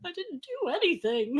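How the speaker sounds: high, soft protest through laughter; mock innocent protest. Partner retelling being sworn at, high laughing protest.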